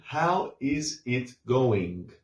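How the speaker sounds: slow, every word "How is it going?" spelled out; careful textbook pronunciation; written full form. Shown as a demonstration. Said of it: "But we don't say all that".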